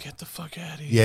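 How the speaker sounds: unvoiced whisper of disbelief. Real, not acted. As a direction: whisper it in shock.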